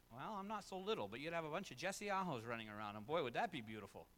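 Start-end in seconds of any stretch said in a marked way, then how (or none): 3.0-4.1 low, dry sarcastic aside. Acted. Pastor jokes to congregation about copies of himself, sarcastic. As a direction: say beautiful while meaning ugly. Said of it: "That was sarcasm".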